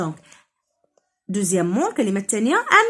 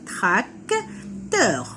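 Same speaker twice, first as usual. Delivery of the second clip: tracteur split into "trac", pause, "teur"; syllable by syllable; 3 semitones lower than usual. Shown as a demonstration. Teacher counting syllables for children, tracteur broken in two. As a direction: beat out tracteur in two drawn-out pieces. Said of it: "On a deux syllabes".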